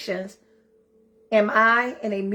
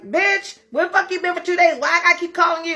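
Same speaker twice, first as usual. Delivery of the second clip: best friend ringing to yell at you; bestie scolding; 6 semitones higher than usual. Retold or imitated. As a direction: play your friend chewing you out. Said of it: "she calls me and is like".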